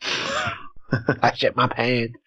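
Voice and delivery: very slow, soft mock sob; fake crying. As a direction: blubber it like a hammy baby. Silly intro bit, fake crying.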